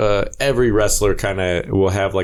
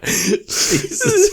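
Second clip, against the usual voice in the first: laughing. Real, not acted. Says it mid-laugh.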